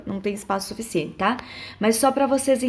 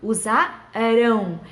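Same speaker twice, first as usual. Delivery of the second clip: "Usarão" very slow, broken before a long "rão"; stressed future ending; 3 dB louder, much slower than usual. Shown as a demonstration. Teacher showing how the future is formed, "Usarão" with drawn-out "rão". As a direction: draw out the final syllable of "Usarão". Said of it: "terminação pro futuro a gente escreve com ão".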